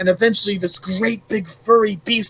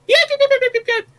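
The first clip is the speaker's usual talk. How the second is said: yelping dog; 4 dB louder, faster than usual. Acted.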